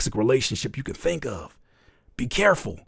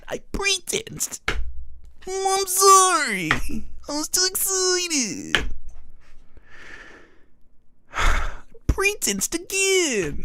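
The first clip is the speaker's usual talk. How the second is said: much higher, slow little voice; squeaky apology; 3 dB quieter, much slower than usual. Pretend. Partner apologizing sheepishly, very high and slow. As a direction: say sorry in a tiny, slow, high voice.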